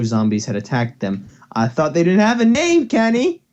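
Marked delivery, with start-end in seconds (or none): 1.6-3.4 louder, higher protest; indignant. Real, not acted. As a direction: call Kenny out. Calling friend out.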